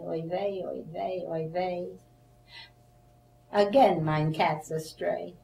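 lightly performed comic verse reading, a bit slow at times; reading her own comic poem aloud to the camera; poem recital. Acted. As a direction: recite the rhyming verses for laughs, with the oy vey refrains.

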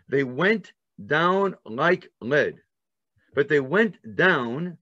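usual speaking voice

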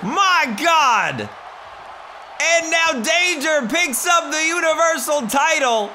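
hype commentator. Pretend. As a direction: call the match like a ringside announcer. Mock wrestling commentary.

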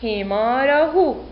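حماره joined slowly as one word; teacher's measured whole-word model; blended word reading. Shown as a demonstration. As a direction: read it unbroken with a long aa. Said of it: "سوف نقرأ الكلمة".

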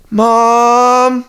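high, drawn-out call for mom; little sister calling out. Retold or imitated. Little sister calling mom at night, high drawn-out call. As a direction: call out as a child wanting mom. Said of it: "it'd start like that".

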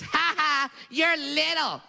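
high-pitched playground mockery; taunting kid. Kid pointing at smaller classmate on first day, mocking. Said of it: "points his finger in my face".

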